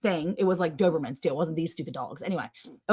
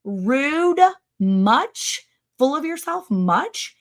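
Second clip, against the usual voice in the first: sarcastic retort; 7 semitones higher, much slower than usual. Retold or imitated. Mocking reply to rude post.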